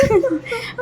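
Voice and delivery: high, breathy burst of giggling; high giggle that nearly turns to a cry. Real, not acted. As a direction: laugh helplessly at herself. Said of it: "मेरे को बहुत हंसी आ रही थी".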